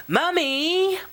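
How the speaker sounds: high call of "Mommy"; child calling Mommy. Acted. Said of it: "Luke said a little louder".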